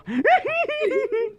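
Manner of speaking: high-pitched real laugh; amused, squealing giggle. Real, not acted. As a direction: burst into a high giggle at your own silliness. Laughs at own Texan accent.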